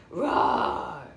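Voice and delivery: low, loud playful growling roar; mock wolf roar, deep and drawn out. Shown as a demonstration. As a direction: roar like the storybook wolf for a toddler. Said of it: "What does the wolf say?".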